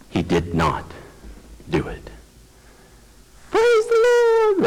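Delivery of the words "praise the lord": high, drawn-out hallelujah cheer; mock jubilation. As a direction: stretch it into a high celebratory whoop. Mock celebration of God relenting.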